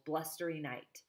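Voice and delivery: plain reading voice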